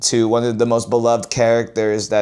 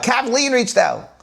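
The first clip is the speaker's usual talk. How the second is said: higher, stressed KATHLEEN; excited repeat; 10 semitones higher than usual. Acted. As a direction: jump up on Kathleen as if amazed.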